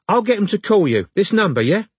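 high-pitched London woman; casual, high London phone voice; London accent. A narrator's reading. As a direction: offer a callback in a London accent. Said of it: "She had a London accent".

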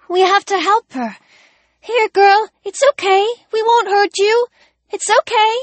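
put-on high, gentle girlish coaxing; girl coaxing a dog. A narrator's reading. Ellie calling the injured collie, high and gentle. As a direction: soothe a frightened animal.

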